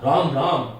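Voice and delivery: राम राम muttered low in distaste; low mock disgust; disgusted exclamation. Shown as a demonstration. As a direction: recoil from something untouchable. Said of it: "जिसको छूना मना है".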